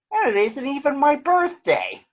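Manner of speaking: high, delighted bird voice; Big Bird voice. Acted. Big Bird tells Granny he's surprised, high delighted voice. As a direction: gush like a kid with a gift.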